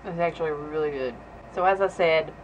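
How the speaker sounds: chewing while talking; speaking right after a bite; mouth full. Real, not acted. Seen 0: bites pizza at -2 to -1, then talks with cheeks full.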